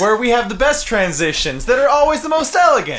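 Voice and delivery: high, fast host patter laughing. Acted. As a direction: pitch the segment through laughter. Mock show host.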